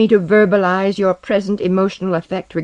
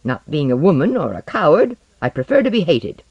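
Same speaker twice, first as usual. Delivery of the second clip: loud, high-pitched, bitter tirade; angry outburst; 6 semitones higher, 5 dB louder than usual. Acted. Osden rails at Tomiko about being hated, furious. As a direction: lash out with scorn, voice raised.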